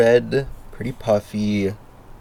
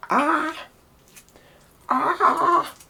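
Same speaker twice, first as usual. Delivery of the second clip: sudden squeaky high yelp. Shown as a demonstration. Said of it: "My best YouTuber voice".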